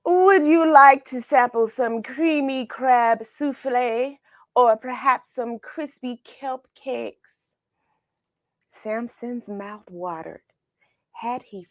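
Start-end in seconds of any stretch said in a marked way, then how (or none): 0.0-7.3 high, smooth serving voice; courteous waiter. Acted. Waiter offering dishes to Samson, smooth and courteous. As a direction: tempt the guest with the menu.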